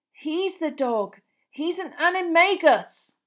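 high, loud and stretched out; frantic revelation. A narrator's reading. Revealing the dog is an animagus, frantic and loud. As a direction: cry it out in alarm, drawing out the words.

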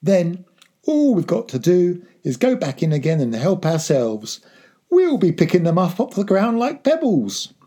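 high, eager and energetic; excited scheming. A narrator's reading. Father telling son the rest of the poaching plan, excitedly. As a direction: build to a loud, gleeful finish.

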